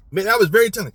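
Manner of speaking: normal talking voice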